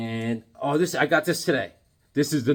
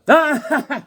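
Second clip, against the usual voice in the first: a sudden loud giggle, much higher; high burst of laughter; 12 semitones higher, 10 dB louder than usual. Real, not acted. Collector laughing at his own comic, high and loud.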